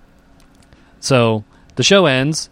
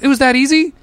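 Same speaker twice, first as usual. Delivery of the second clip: surprised; 11 semitones higher than usual. Retold or imitated. Stunned disbelief retold.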